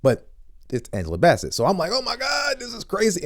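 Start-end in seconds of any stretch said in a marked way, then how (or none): 1.9-3.2 high-pitched fanboy gush; retold starstruck squeal. Retells being starstruck at seeing actress.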